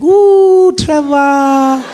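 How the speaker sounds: put-on high, loud, slow delighted old lady; grandmother. Gogo congratulating Trevor on the phone, slow and high. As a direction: gush like a proud granny. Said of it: "And she's like".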